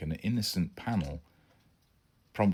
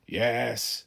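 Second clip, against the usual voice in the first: resigned; 5 semitones higher than usual. A narrator's reading. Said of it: "said Dad in a resigned tone".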